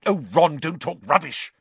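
put-on girl snapping at a silly friend. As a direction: snap at him impatiently. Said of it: "snapped Hermione".

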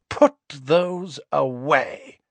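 slow, cold command; icy and unhurried; cold Narcissa. Acted. Narcissa coldly ordering Harry and Ron, slow. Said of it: "she said coldly".

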